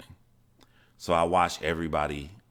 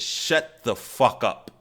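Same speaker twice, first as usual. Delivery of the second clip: higher, slow, emphatic acted-out order; angry grandmother; 6 semitones higher, 3 dB louder, much slower than usual. Grandmother shutting up the child before the aunts, angrily. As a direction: imitate a grandmother slowly silencing a child.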